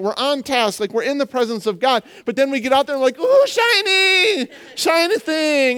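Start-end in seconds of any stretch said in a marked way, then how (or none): 3.2-5.8 high, drawn-out mock amazement; distracted wonder. Preacher mimicking believers distracted outside church, gleeful wonder. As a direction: squeal it like a dazzled child.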